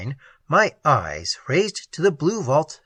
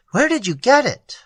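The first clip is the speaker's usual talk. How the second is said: loud, harsh, higher demand; harsh cry; 9 semitones higher, 4 dB louder than usual. A narrator's reading. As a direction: snap the question harshly. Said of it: "I cried harshly".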